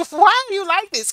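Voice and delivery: put-on high, loud exasperated teen; mock exasperated daughter. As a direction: whine it like a fed-up daughter. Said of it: "my daughter be looking at me like".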